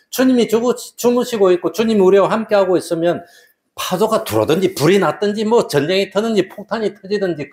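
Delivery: impassioned sermon climax; fervent preaching. Acted. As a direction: drive the point home from the pulpit.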